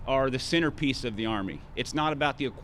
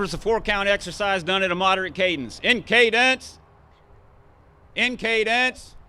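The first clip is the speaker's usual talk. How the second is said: loud, higher drill-sergeant cadence calls; drill commands; 8 semitones higher, 8 dB louder than usual. Shown as a demonstration. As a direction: bark PT commands at the formation. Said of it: "I need my demonstrator to post here".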